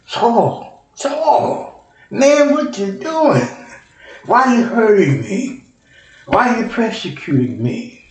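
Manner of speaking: high calling voice of Jesus, pleading. Retold or imitated. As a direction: call out Saul's name high and pleading.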